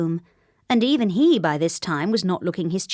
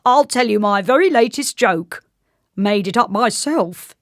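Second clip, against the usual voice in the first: a hint of a proud joker, lightly raised; modestly; 4 semitones higher, 7 dB louder than usual. A narrator's reading. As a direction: brag gently about the joke. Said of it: "he added modestly".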